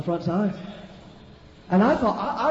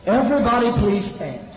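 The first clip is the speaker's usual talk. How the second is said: loud, raised pastor's announcement; calling out; 4 semitones higher, 6 dB louder than usual. Retold or imitated. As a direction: call the whole congregation to order. Pastor calling congregation to rise.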